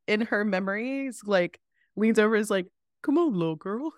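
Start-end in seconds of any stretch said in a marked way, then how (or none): 3.0-3.9 funny mascot character voice; comic impression of a mascot coaxing a child; Buster Scruffs. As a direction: put on a silly voice for a theme-park mascot. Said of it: "he's got the funniest voice".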